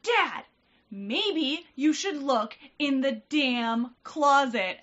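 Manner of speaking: high, loud, slow exasperated outburst; imitated fed-up child. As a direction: act out a little girl scolding her dad. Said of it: "she just finally got fed up".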